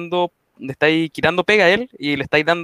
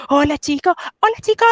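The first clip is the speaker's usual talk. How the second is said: high sugary influencer greeting; much higher, gushing hello; Chris Hemsworth impression; 11 semitones higher than usual. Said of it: "después hace un video".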